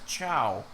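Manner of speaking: "Chow" with ch in place of sh; imitated mispronunciation. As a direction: say "Chow" for Shaw, rhyming with cow. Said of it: "she was pronouncing it as".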